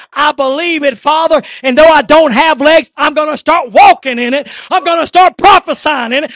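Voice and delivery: climbing from steady preaching into a shouted, fast prayer, pitch rising; preaching at full force. Acted. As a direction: pastor urging the little bride to pray, preaching at full force.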